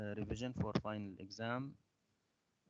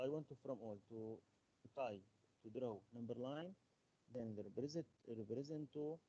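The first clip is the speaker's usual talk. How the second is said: soft, barely projected instructions; low voice; 7 dB quieter than usual. Real, not acted. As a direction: give the instructions too quietly. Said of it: "your voice is so low".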